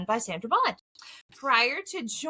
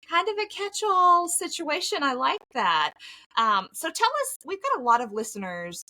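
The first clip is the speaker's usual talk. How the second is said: ordinary talk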